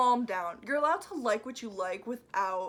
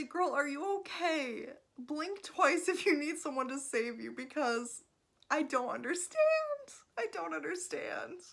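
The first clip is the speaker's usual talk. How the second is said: mock concerned plea; 7 semitones higher than usual. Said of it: "Again, I say".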